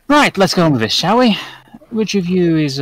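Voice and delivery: clap-the-hands briskness, lightly put on; Asmodeus. Asmodeus moving the party on to the offering, brisk.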